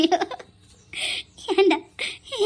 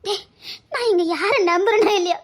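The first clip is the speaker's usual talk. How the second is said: tearful lament. Acted. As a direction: wail and beg him to wake. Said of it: "இப்ப பைத்தியக்காரி மாதிரி புலம்பியதுக்கு". (அழுகையோடு மெதுவாக புலம்பல்)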